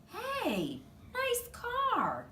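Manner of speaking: a hint of an impressed man eyeing a car; admiring janitor. A narrator's reading.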